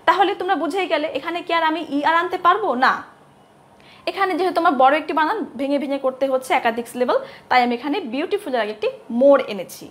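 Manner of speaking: normal talking voice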